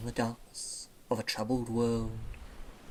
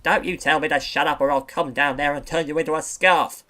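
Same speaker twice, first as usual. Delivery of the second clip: much louder furious threat; angry threat; 9 dB louder than usual. Acted. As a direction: shout him down. Seal threatening the otter.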